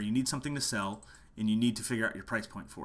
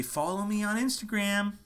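mock musician plug; 10 semitones higher, 4 dB louder than usual. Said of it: "they say".

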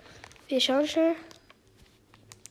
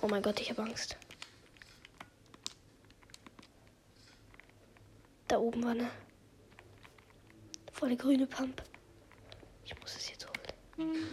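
hushed frightened whisper; real nervous whisper; scared whisper; 13 dB quieter than usual. Real, not acted. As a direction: whisper in fear near an enemy. Scared, enemy nearby.

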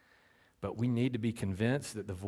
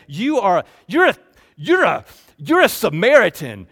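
loud, higher jeering accusation; mocking taunt; 7 semitones higher, 17 dB louder than usual. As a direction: fling the insult like a schoolyard taunt. Crowd taunting Jesus, shouting.

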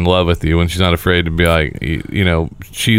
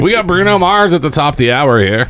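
higher mock DJ plug; radio voice; 7 semitones higher than usual. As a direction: tease the next song like a station host. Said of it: "That'd be my radio voice".

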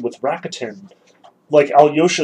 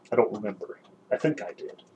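faint weary answer; 3 semitones lower, 9 dB quieter, faster than usual. A narrator's reading.

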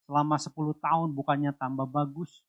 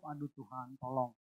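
very soft, spent plea to God; barely-voiced cry for help; exhausted murmured prayer; whispered plea; 4 semitones lower, 12 dB quieter than usual. Retold plea in despair.

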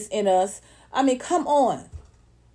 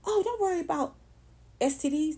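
high, soft sales voice; high, gently coaxing salesperson; mock pill pitch; 5 semitones higher, 5 dB quieter than usual. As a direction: imitate a soothing drug advert, pitched up. Imitates sellers pushing a pill.

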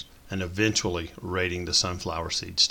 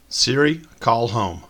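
raised voice, higher, ordering Siri; command to phone; 4 semitones higher, 5 dB louder, slower than usual. A narrator's reading. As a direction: speak up at the phone. Jim Bob commands phone.

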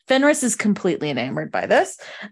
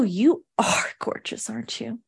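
whisper; 6 dB quieter than usual. Acted. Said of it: "And she goes".

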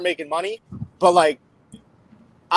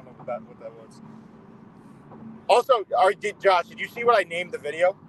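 usual speaking voice

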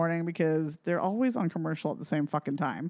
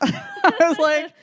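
high, slow words through laughter; laughing; 7 semitones higher, 4 dB louder, much slower than usual. Real, not acted. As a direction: let the laugh lift the voice. Laughing over her own rant.

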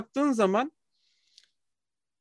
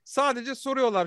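ordinary talk, nothing marked

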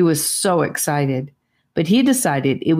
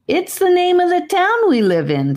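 put-on a much higher boy's voice, mildly incredulous. A narrator's reading. Gabe answering Rivers, lightly incredulous. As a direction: tell him as if it's obvious. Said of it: "looking at him like he had gone cuckoo".

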